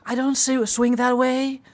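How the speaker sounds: high, bright imitation of her; high female character voice. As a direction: squeak it out in her voice. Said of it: "she will be like".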